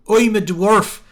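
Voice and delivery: high, loud hammed-up dwarf voice. Shown as a demonstration. Streamer mocking heavy phonetic dialogue, hammed-up dwarf voice. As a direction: lay the dwarf accent on thick. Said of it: "you can do one clue".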